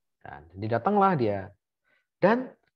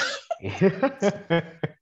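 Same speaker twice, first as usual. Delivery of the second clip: laughing; 9 semitones higher, 3 dB louder than usual. Real, not acted. Laughing at teasing about looks.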